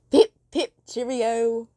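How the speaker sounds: playful fake British accent, laughing through it; mock fancy British accent. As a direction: ham up a toff from London. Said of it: "pretending I'm, like, a fancy Brit".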